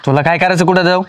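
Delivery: loud, irritable brush-off. Acted. Husband brushing off wife's question about where he went, irritably. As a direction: raise your voice, testily shutting down the question. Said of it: "एवढं का चिडचिड करताय".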